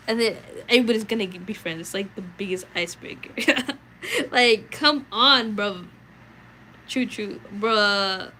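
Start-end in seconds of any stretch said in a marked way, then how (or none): 4.3-5.8 slow, loud, exasperated drag; come on bro. Real, not acted. Streamer begs chat to drop the age topic, exasperated. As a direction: plead with chat, fed up.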